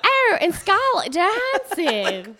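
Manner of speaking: put-on high, very drawn-out Australian accent; over-the-top Australian drawl; Chris Hemsworth. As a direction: greet her in hammed-up Australian, slowly. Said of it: "like, strong accent".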